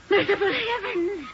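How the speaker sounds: high-pitched shocked cry; alarmed exclamation. Acted. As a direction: exclaim in sudden fright. Startled in the rocking chair.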